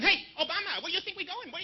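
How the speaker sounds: high, jeering mock voice; mocking schoolyard challenge; taunting bullies. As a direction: punk him out in a high-pitched taunt. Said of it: "They're going".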